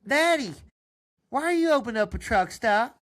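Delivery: put-on high loud kid asking daddy; curious boy calling to his dad; little boy. Son asking daddy about the truck stop, high kid voice.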